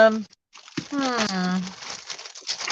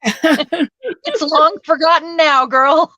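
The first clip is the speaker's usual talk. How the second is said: laughing; 7 semitones higher, 3 dB louder, slower than usual. Real, not acted. Laughing at choking friend.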